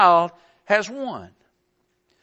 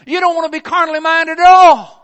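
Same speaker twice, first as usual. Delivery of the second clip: louder, higher, hammering "at all"; preaching shout; 7 semitones higher, 12 dB louder than usual. Real, not acted. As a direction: drive "at all" home hard. Preacher builds to a climax.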